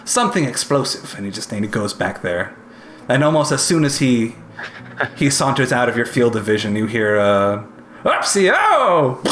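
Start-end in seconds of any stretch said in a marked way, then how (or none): 0.0-1.1 high-pitched, dithering tinkerer; breezy mentor Olgath. Acted. 8.0-9.2 high, drawn-out comic yelp; startled mishap cry. Acted. Tinkerer's mishap heard from back room.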